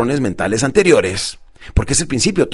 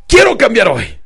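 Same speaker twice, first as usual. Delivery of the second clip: high, loud, emphatic declaration; with great eagerness; 7 semitones higher, 6 dB louder, slower than usual. Shown as a demonstration. Coach demonstrating for his client, very eager. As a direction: punch it out with full drive. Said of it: "dígame con muchas ganas".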